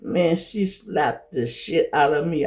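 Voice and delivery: usual speaking voice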